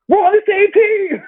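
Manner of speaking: high, loud imitated yell; hammed-up teammate yell; mock yell. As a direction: yell it in a squeaky mock outrage. Retelling Aaron's yell on court.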